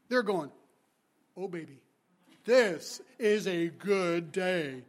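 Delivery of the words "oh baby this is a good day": slow, low, savouring every word; drawn-out gloating over good luck; relishing. Acted. Worker at the back expecting a big payout, relishing.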